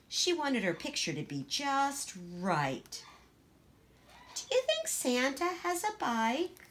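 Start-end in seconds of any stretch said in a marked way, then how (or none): 4.3-6.7 put-on small, timid little girl voice; Gracie, shy child. Shy little Gracie asking her sister, timid.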